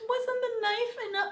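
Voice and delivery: high, squeaky disbelief; incredulous. Retold or imitated. Viewer questioning the captor's logic, high incredulous. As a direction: pitch it up in outraged disbelief. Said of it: "you're thinking".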